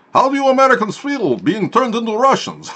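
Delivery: high, loud hammed-up Russian taunt; mock Russian accent. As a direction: taunt the Americans as a cartoon Russian.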